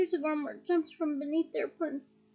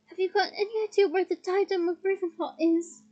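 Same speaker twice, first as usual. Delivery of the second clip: usual speaking voice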